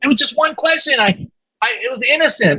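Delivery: high-pitched, stammering protest of innocence; defensive pleading. Retold or imitated. Young interviewer to angry bosses, pleading innocence.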